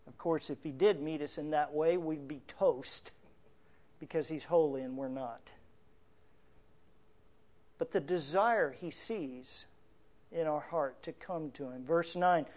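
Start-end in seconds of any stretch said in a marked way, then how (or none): none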